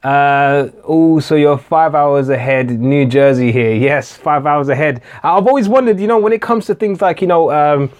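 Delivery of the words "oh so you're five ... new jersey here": ordinary delivery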